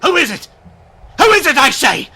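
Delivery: high, loud, panicked call; frightened cry. Acted. Startled man crying out at an unseen visitor. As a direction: shout into the dark, terrified.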